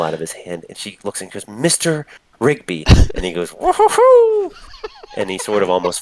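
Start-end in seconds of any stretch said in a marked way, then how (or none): 1.5-2.8 slow, higher-pitched reproach, each word separated; scolding. Acted. Woman takes drunk man's bottle, slow and scolding. 3.5-4.5 high, loud silly whoop; drunken whoop. Acted. Drunk man reacts to being scolded, high giddy whoop.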